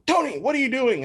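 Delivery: high, loud mock scolding; high-pitched telling-off; scolding boss. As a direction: imitate a boss pouncing on a slacker. Voices tough boss catching him crying.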